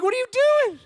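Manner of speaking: high, loud parental outburst; exasperated yell. Retold or imitated. Dad to son lying limp on the floor, exasperated yell. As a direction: yell it in high-pitched disbelief.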